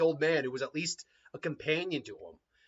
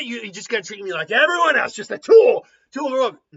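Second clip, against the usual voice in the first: angry demon; 4 semitones higher, 9 dB louder than usual. Acted. Shukaku angry at Gaara.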